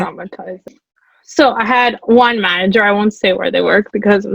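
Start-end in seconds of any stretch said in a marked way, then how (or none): 0.0-0.8 breathless whispered aside; soft unvoiced reaction to a shocking story. Real, not acted. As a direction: breathe it out under the voice. Listener reacts to customer story.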